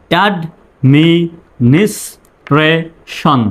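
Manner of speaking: drawn out for repeating; slow call-and-repeat demo; slow model. Shown as a demonstration. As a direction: model it slowly to echo. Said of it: "আমার পরে আপনি বলবেন".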